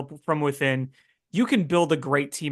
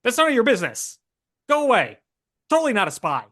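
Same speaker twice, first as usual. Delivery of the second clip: put-on higher, pushy old-movie Transatlantic tough-guy accent; 1950s Transatlantic accent; 7 semitones higher, 4 dB louder, faster than usual. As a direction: play a 1950s Transatlantic-accent spy who insists he isn't one, fast and defensive. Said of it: "He's always got a cigarette in his mouth".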